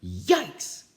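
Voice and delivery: loud, high yelp of fright; scared cry. Acted. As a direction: yelp like startled kids. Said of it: "cried the kids".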